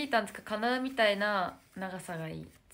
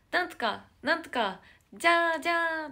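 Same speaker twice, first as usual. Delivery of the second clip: louder, climbing chant of a concert call; mock fan call; 8 semitones higher, 9 dB louder than usual. Replaying the fan call she shouted at the concert, loud. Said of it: "めっちゃ大声で".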